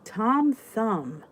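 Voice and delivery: ordinary delivery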